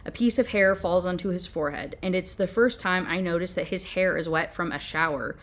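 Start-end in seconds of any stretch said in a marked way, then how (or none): none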